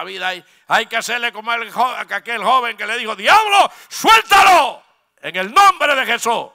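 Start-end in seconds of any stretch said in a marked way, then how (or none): 3.1-6.5 loud, high command to the devil; young man casting out the devil with power; authoritative shout. Retold or imitated. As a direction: rebuke with authority. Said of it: "con autoridad, con poder".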